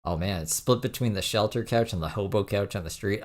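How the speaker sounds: ordinary talk, nothing marked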